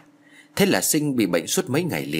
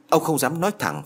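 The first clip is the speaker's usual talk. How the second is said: ordinary delivery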